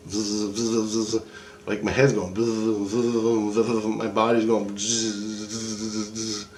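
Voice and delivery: soft imitated zzz pulses, fading away; electric buzzing. Describing the dream's electric pulses, a soft fading buzz. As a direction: buzz like current pulsing through you. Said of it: "I can feel my soul going like".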